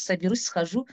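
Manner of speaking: normal talking voice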